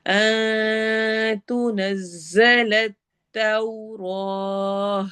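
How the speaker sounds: slow, drawn-out tajweed chant; Quran recitation. Shown as a demonstration. Teacher recites verse for students, slow tajweed chant. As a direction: recite it slowly, stretching each sound. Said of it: "नून की आवाज़ को छुपाना है".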